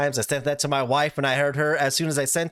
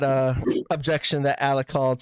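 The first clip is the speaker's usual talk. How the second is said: ordinary talk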